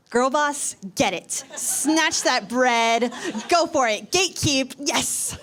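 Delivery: loud, punchy mock hype; hyped cheer. Friends egging the comedian on, hyped and loud. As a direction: cheer her on in big, punchy bursts. Quoting people cheering her on.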